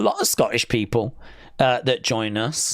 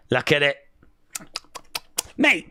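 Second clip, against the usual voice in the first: ordinary talk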